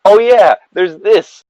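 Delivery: usual speaking voice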